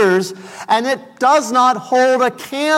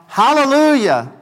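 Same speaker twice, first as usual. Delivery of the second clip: raised, higher Hallelujah as the crowd cries out; voicing the multitude; 5 semitones higher, 3 dB louder than usual. Preacher voicing heaven's crowd, raised and higher. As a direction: lift it like a shout of praise.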